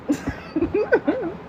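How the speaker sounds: a squealing laugh that rises at the end; high mocking laugh. Real, not acted. Laughing at the commenter.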